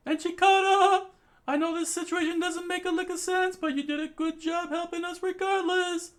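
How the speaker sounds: high loud voice, teasing then thankful; grateful girl. Acted. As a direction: thank Chikara warmly.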